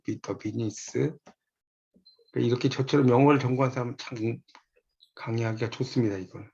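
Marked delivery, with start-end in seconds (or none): 0.0-1.1 The business repeated very slowly and softer, rising at the end; soft, slow repetition of the key phrase for students; slow quiet repeat. Shown as a demonstration. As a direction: quietly point back to The business, drawn out. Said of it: "이건 무슨 영어 수업 하는 것 같아요".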